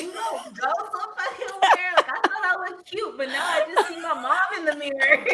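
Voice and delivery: loud, high mock rant through laughter; high-pitched, giggly protest; laughing outrage. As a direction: crack up while telling off your sister. Acted comeback to sister, cracking up.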